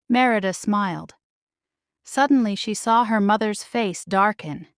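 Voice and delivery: usual speaking voice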